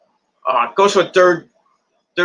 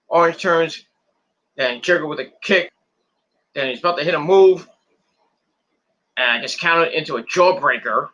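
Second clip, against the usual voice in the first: ordinary talk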